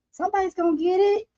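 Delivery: पतली ऊँची चिढ़ाती आवाज़; high teasing singsong. Pretend.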